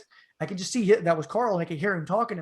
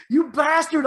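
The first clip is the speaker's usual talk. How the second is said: high, loud accusing shout; angry shout; 9 semitones higher, 8 dB louder than usual. Retold or imitated. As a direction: yell as you pile onto him. Hitting friend, accusing.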